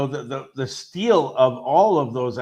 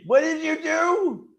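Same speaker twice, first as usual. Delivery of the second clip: alarmed Bruce Banner; 12 semitones higher, slower than usual. Retold or imitated. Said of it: "he's like".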